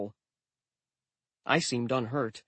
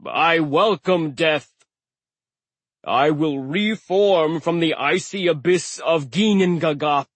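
sneering giant; 3 semitones higher, 5 dB louder than usual. A narrator's reading. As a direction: sneer down at a weakling.

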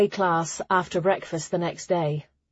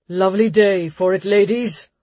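hearty warrior greeting; 6 dB louder than usual. Acted. Warrior's cheerful greeting in gale.

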